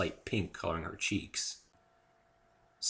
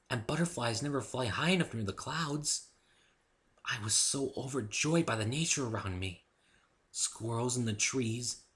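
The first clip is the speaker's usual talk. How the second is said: dreamy Fluttershy; 6 semitones higher than usual. Acted. Fluttershy tells Dave of nature, high and dreamy. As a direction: savour each memory.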